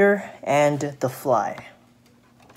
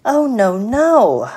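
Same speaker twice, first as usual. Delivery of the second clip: high, drawn-out protest as the fly; slow, higher-pitched little fly turning it down; little fly refusing; 6 semitones higher, 6 dB louder, slower than usual. Acted.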